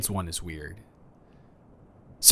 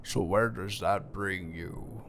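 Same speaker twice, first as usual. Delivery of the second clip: Bane voice; 2 semitones lower, 5 dB quieter, slower than usual. Acted. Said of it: "I said that in a Bane voice".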